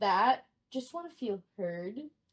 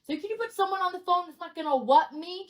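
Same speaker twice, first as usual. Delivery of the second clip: loud, higher-pitched mock tirade; outraged customer; 4 semitones higher, 4 dB louder than usual. Paying customer berating a trainee, loud and indignant. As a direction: tell them off in hammed-up indignation.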